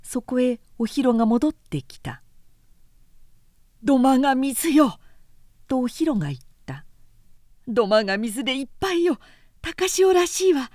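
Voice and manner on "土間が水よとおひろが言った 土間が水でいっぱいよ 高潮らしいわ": startled warning; alarmed cry. Acted. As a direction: call out the flood in alarm. Flood found, Ohiro alarmed.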